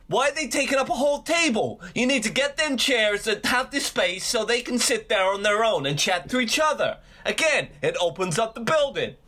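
mock outraged rant. Friend as TV bar rescuer telling off a pub boss about seating, mock outrage. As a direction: lecture indignantly in a high, hammed-up voice. Continues John Taffer impression berating pub boss.

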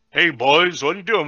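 lightly imitated adult voice on a kid; grown man's voice. Fan mocking an adult-sounding child character, light impression. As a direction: mimic a grown-up supposedly playing a boy. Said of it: "his voice is like".

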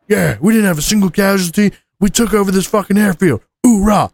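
mock triumphant hoorah; mock marine boast. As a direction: puff up like a bragging grunt.

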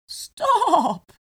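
loud neighing Stop; whinny it like a horse. Acted. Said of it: "neighed the horse".

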